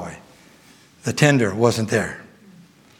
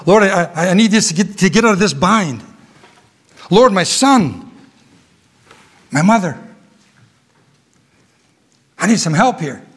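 mock selfish prayer; 4 semitones higher than usual. Preacher mocks a selfish prayer, pleading. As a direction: ham up a needy plea to God. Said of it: "That's not what Joshua's prayer was about".

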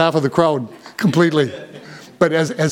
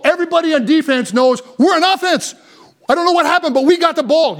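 preacher fired up about football; excited preaching; 7 semitones higher than usual. Real, not acted. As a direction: relive the interception with energy.

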